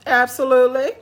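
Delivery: loud, high burst of eagerness; eager absolutely. Real, not acted. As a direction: jump at the chance. Eager agreement to meet.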